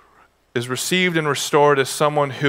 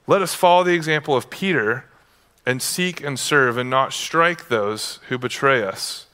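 normal talking voice

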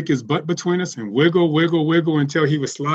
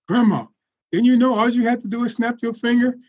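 put-on child nephew voice; 8 semitones higher than usual. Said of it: "my nephew goes".

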